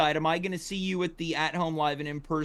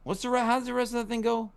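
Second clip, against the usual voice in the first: ordinary talk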